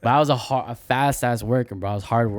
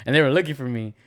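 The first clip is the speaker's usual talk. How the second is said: ordinary delivery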